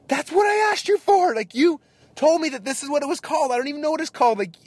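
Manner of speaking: loud, very high-pitched exasperated yell; retold livid outburst. Customer confronts server over wrong dish, livid and high. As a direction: blow up at the server, voice shooting way up. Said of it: "lividly mad".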